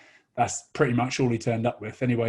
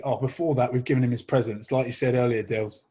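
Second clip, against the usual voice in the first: ordinary delivery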